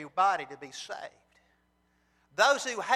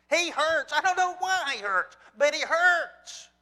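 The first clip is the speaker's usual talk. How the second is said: impassioned preaching; 7 semitones higher, 6 dB louder than usual. Real, not acted. Preacher on how much God hurts, higher, louder, pleading. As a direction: cry it out, pleading. Preacher stressing God's pain.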